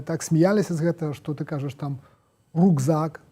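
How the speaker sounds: ordinary delivery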